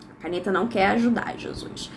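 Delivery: quick, near-whispered grumble to oneself; hushed aside. Real, not acted. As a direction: drop to a very soft, voiceless aside. Muttered aside about the pen.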